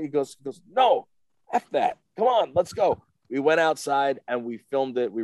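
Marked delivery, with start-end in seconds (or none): 0.7-3.0 loud, high, insistent urging; forceful retold insistence; emphatic brush-off. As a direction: wave away the objection and rally them. Retold star brushes off apology.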